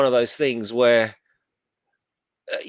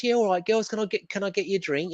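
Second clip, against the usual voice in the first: mock bloke chatting up, much higher; hammed-up pub bloke; 8 semitones higher than usual. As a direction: ham up the cheeky bloke offering drinks. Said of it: "and he goes".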